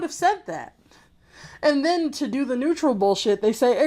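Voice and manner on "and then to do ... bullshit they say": ordinary delivery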